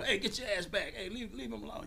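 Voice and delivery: high-pitched warning to the crowd; costume handler. Retold or imitated. Handler warning guests off the costume, high voice. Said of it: "So they can go".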